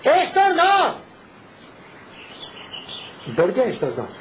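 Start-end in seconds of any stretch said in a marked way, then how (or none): 0.0-1.0 high, forceful refusal; powerful high-pitched cry; strong, powerful voice. Retold or imitated. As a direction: exclaim it like an indignant priest. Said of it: "con voz fuerte y potente".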